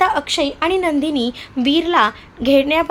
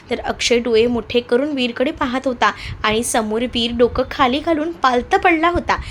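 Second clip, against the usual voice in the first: usual speaking voice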